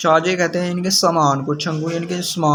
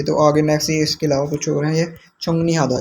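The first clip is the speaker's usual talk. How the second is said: ordinary talk, nothing marked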